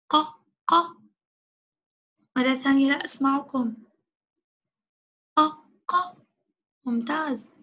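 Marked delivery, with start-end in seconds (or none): 0.1-0.7 short, crisp "ق" with an a vowel; qaf with fatha. Shown as a demonstration. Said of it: "كيف ننطق القاف مع الفتحة". 5.4-6.2 short, crisp "ق" with an a vowel; qaf with fatha. Shown as a demonstration. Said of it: "كيف ننطق القاف مع الفتحة".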